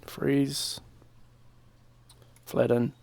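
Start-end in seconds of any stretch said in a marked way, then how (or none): none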